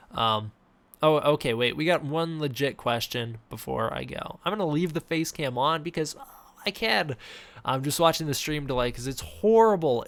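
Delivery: ordinary delivery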